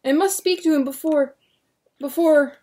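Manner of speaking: high loud urgent demands; frantic demands. Acted. William demanding the deputy halt it, frantic loud demand. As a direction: demand answers now.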